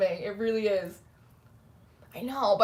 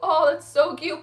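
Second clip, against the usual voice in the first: high, gushing coo; 6 semitones higher, 3 dB louder than usual. Real, not acted. Streamer cooing at a viewer's news. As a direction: coo over something adorable. Reacting to a chat comment.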